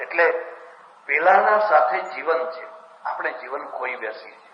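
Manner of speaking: ordinary talk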